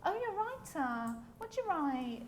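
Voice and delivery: a hint of a polite party guest making conversation; dinner party guest. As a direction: mildly mimic a dinner guest's interest. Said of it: "dinner party situations and people say".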